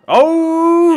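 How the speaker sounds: high, loud sudden gasp; surprised shout. Real, not acted. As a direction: burst out in surprise. Reacting to the winning hit.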